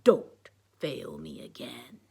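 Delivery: slow, low villain voice; Darth Paper. A narrator's reading. Harvey's puppet threatening, slow and low villain. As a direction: warn slowly as a puppet dark lord. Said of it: "said Darth Paper".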